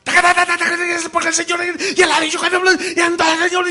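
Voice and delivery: high, loud rapid-fire shouting preacher; machine-gun preacher. Retold or imitated. Mocking a preacher who fires words like a machine gun, shouting. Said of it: "alguien que parece metralladora".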